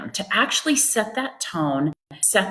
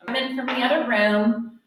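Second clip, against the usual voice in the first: from another room; 5 semitones higher than usual. Acted. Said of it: "I'll put my chair right here".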